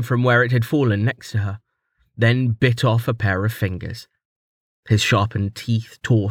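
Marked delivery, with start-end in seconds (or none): none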